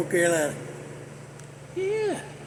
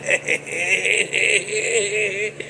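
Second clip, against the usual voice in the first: chuckling; 2 semitones lower, 5 dB quieter than usual. Real, not acted. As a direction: chuckle on and on at your own mischief. Laughs at own story.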